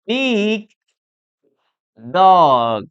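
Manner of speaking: "big", pause, "dog", each drawn out with a hard G; loud, slow model of the G ending in "big" and "dog"; same final sound. Shown as a demonstration. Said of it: "el mismo sonido".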